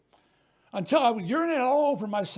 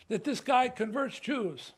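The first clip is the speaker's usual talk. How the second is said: normal talking voice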